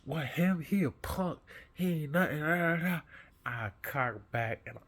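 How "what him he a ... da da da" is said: mocking bully. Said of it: "he finally was like".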